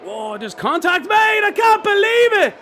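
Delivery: high, loud crash call; excited shout. Real, not acted. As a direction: yell it as the crash happens. Crash called live.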